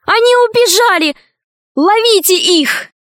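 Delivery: high-pitched cry of alarm. A narrator's reading. Mermaid on the railing alerts everyone to the escape, high cry. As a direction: call out high, raising the alarm. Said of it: "закричала".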